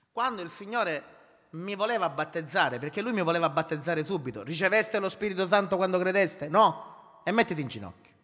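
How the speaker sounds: loud, driving sermon voice; preaching at full force. Acted. Pastor preaching to his congregation, loud and driving. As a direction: preach it out to the whole church.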